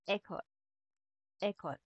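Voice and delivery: "École" said softly, opening on a closed é; French pronunciation. Shown as a demonstration. Teacher repeating an accent aigu example, soft closed é. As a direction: model "École" with the first vowel like "day". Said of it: "E na may accent na aigu".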